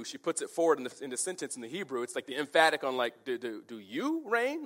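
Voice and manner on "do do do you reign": slow question with "you" pitched up; stress on YOU. Shown as a demonstration. Wife questioning the king's rule, stress on "you". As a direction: lean hard on "you" as the emphatic word. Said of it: "the emphatic is the you".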